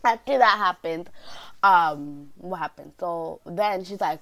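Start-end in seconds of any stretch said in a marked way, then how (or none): none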